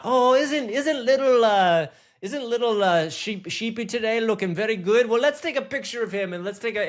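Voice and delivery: high, far-off mock accent of a New Zealand or Scottish farmer, hard to place.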